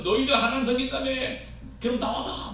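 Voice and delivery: quick, high-pitched sneer; mocking taunt. Goliath mocking those who serve God, high and fast. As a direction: dare them to come out, scoffing.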